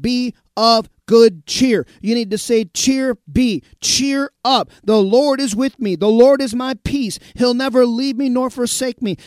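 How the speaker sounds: loud, rising sermon exhortation; full-force preaching. Acted. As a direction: preach it hard to the congregation.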